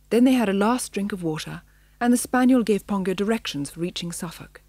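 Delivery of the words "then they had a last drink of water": usual speaking voice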